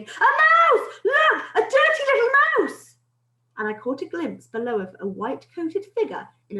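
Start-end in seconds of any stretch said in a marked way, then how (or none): 0.0-2.9 high, loud alarmed yell. A narrator's reading. Cook spotting a mouse in the kitchen, yelling. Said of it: "I heard a man's voice yelling".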